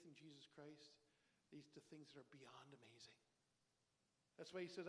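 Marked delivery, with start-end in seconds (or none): 0.1-3.2 whisper. Real, not acted. Sermon point dropped to whisper.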